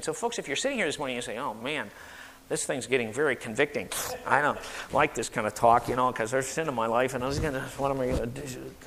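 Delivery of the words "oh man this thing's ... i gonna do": low, hurried grumble; uneasy convicted listener. Acted. Churchgoer squirming at convicting talk, low hurried grumble. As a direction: mumble it fast and low, squirming.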